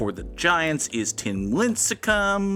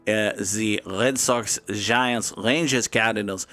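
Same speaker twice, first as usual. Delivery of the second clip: put-on comic French accent; terrible French accent; 3 semitones lower than usual. Streamer introducing today's grid in a terrible French accent. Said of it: "[name]'s terrible French accent".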